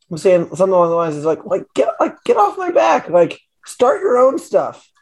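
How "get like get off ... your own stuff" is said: high-pitched mock rant; retold rant, pitched way up; exasperated retort. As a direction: imitate a comic's fed-up outburst, stretching the words. Acting out comedian's exasperated rant.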